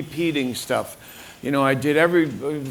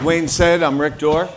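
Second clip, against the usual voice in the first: louder, projected introduction; speaking up; 2 semitones higher, 4 dB louder than usual. Real, not acted. Speaker repeating his introduction after the host's prompt, speaking up. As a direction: project your name so the room hears. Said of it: "Speak up, Rick".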